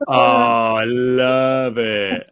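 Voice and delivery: loud, long drawn-out relish; real delight. Real, not acted. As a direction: savour every word with noisy delight. Delighted reaction to funny sign headline.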